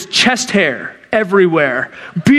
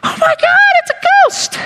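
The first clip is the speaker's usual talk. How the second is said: put-on terrified little boys. Disciples panicking at a ghost on the water, high terrified squeal. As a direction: shriek it like frightened kids seeing a ghost. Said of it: "these men turn into little boys".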